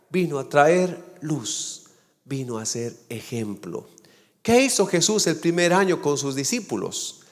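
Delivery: ordinary talk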